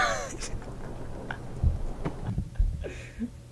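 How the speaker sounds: long, soft, high airless laughter; wheezing laugh. Real, not acted. Player cracking up after fright, wheezing laugh. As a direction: laugh until the voice goes.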